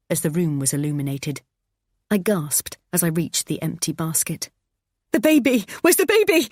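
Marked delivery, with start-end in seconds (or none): 5.1-6.5 high panicked scream. Acted. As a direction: shriek in terror for the baby. Mother finds empty basket.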